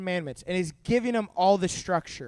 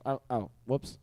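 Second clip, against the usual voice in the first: sheepish whoops; 11 semitones lower, 10 dB quieter than usual. Retold or imitated. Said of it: "And I'm like".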